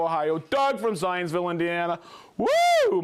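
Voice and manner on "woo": high loud woo; a loud happy whoop; excited whoop. Real, not acted. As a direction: cheer it out big. Excited cheer for a viewer.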